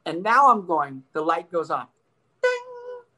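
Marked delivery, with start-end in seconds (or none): 2.4-3.0 very high sung-out ding; imitated ding. Driver suddenly understands, high imitated ding. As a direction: chime like a bell of realization.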